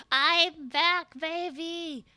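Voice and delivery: triumphant shout. Real, not acted.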